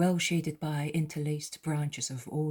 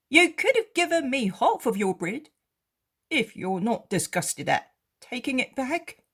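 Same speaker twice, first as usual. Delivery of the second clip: higher voice for the beggar, wondering; a poor man asking why, raised and high; ragged beggar, puzzled; 8 semitones higher, 7 dB louder than usual. Acted. Beggar speaks to Jesus.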